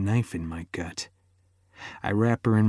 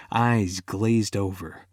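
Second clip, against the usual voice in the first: ordinary delivery, nothing marked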